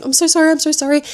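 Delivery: high, rapid mock panic; freaking-out apology. Nikki apologizing after her outburst, freaking out in imitation. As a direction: rattle out repeated apologies high and fast. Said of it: "start freaking out and saying".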